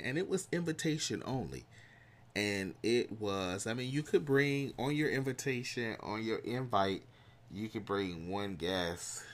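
normal talking voice

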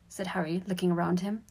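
ordinary delivery, nothing marked

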